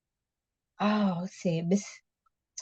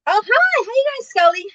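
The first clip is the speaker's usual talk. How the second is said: excited greeting; 4 dB louder than usual. Real, not acted. Greeting a viewer in live chat.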